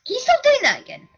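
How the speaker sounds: high-pitched annoyed complaint. Real, not acted. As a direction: tell them off, voice jumping up high. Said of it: "Really, it's annoying me".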